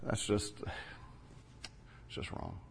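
barely whispered, wounded; whispered hurt. Acted. Hurt by insult to his work.

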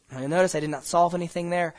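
ordinary delivery

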